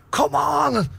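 high, stretched-out call; retold urgent cry. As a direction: cry out to will him back. Retold shouting at flatlined mate.

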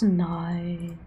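normal talking voice

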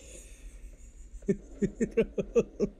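breathy giggling laugh; can't stop laughing; laughter. Real, not acted. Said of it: "the humor of this game just catches me off guard".

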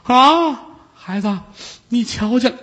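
put-on high, startled old woman; old aunt startled, high and anxious.

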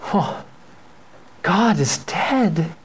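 slow, tearful mourning, lightly acted; a hint of a sobbing wife, drawn out slowly; mock weeping wife. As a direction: grieve aloud as a weeping wife. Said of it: "she sits down weeping".

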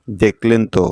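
ordinary talk

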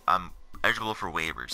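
ordinary talk, nothing marked

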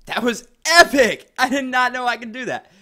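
thrilled shout, high and loud; elated, can't believe it worked; excited. Real, not acted. Surprise at a game move.

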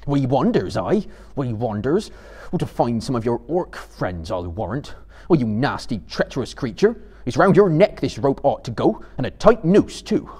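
loud, angry growl with mocking edge; Sam. A narrator's reading. Sam threatens treacherous Gollum with the rope, growling. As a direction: growl threats at a sneaking captive. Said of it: "he growled".

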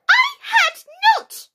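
very high, slow furious squeak; very angry. A narrator's reading. Mouse contradicting Alice, sharply and very angrily. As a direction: shout each word sharply, high and loud. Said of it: "sharply and very angrily".

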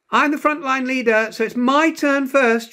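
put-on high, loud bossy child's yell. A narrator's reading. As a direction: shout it to claim first place. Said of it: "she yelled".

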